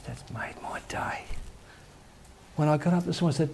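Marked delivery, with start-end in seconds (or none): 0.0-1.2 slow, soft whispered thanks; grateful whisper. Retold or imitated. Comforted lady thanking her pastor, in a slow whisper. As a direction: whisper your thanks, slowly.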